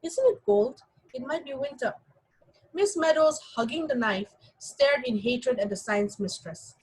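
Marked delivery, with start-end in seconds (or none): 0.0-1.9 high, sugary chatter about the weather; affected, honeyed small talk; sweet affected drawl. Acted. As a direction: coo it with false sweetness. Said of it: "in her sweet, affected drawl".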